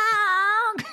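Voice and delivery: long high-pitched chicken cry; comic imitated chicken noise; chicken squawk. Shown as a demonstration. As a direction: demonstrate a silly hen squawk. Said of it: "I just started doing this".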